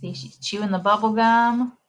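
ordinary delivery

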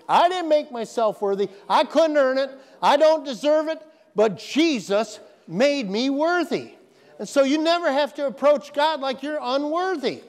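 high, forceful sermon delivery; preacher building to an emphatic peak; impassioned preaching. Real, not acted. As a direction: drive it home to the congregation, pitch lifted.

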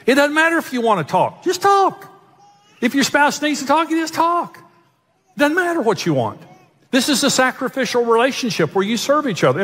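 high, urgent preaching; impassioned pulpit voice; preaching at full force. Real, not acted. Preaching climax, raised voice.

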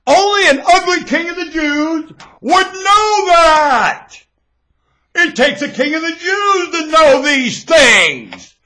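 lightly voiced movie character, loud and slow; movie line. Quoting a line from the Josie Wales movie, a light put-on character voice. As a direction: quote the film like you're playing the part. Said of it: "Josie Wales".

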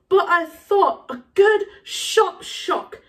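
slow, emphatic self-justification; high, loud, spaced-out stresses; Moody. Acted. Moody justifies his punishment.

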